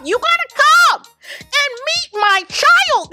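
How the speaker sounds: high, loud, gushing mock Shelly; gushing invite. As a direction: squeal the invite like an overeager friend.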